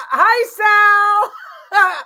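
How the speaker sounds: high, loud laughing greeting; giddy laughing squeal. Real, not acted. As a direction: squeal hello through a laugh.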